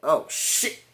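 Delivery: hushed, voiceless mock alarm; imitating stunned dismay. Reviewer acting Kong looking down at the attack, hushed mock alarm. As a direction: let it out as a breathy mock gasp of dread. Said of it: "King Kong looked down like".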